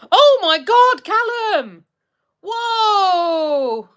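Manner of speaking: loud, high cry of amazement; real excited whoop; thrilled outburst. Real, not acted. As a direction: blown away, shout the thanks out high. Thrilled reaction to a shout-out.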